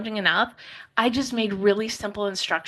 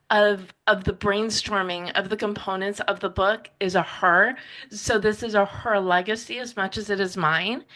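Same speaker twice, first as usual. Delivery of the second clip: ordinary talk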